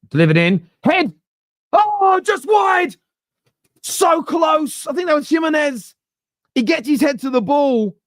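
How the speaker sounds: high, loud live football commentary; commento calcistico in diretta. Real, not acted. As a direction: shout the near miss, voice soaring.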